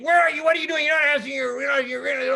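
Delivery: high, loud scolding questions; scolding French officer. Retold or imitated. French officer scolding a sailor over the radio, loud and high. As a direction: give him heck like an irate officer. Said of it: "French voice is giving me heck".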